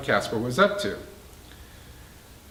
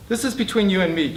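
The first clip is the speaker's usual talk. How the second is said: controlled, firm and a touch higher; tight, firm voice; 6 semitones higher than usual. A narrator's reading. J C facing Casper alone, tight and firm. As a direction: hold the anger down, keep it tight. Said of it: "in a tight, firm voice".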